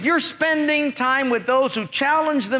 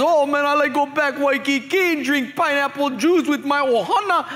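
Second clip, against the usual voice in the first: put-on pidgin accent, higher voice; Hawaiian pidgin; 4 semitones higher than usual. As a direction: play the Hawaiian guy dreaming of Waikiki. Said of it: "The Hawaiian guy says".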